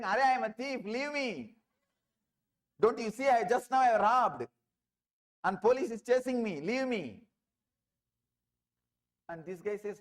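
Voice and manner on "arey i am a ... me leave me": higher, louder, hurried thief pushing the man away; panicked thief. Retold or imitated. Thief to the clinging seeker, loud and panicky. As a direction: shake him off, he's a thief on the run.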